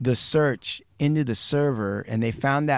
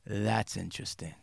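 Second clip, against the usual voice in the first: soft, low, measured reply; understated; 5 semitones lower, 10 dB quieter than usual. Retold or imitated. DA on the conference call after dead silence, quiet understatement.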